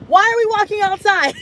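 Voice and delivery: high, baffled why-are-we-doing-this; incredulous. Retold or imitated. To the gate staff, baffled at walking outside. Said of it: "And I was like".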